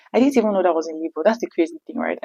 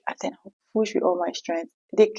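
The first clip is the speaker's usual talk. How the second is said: normal talking voice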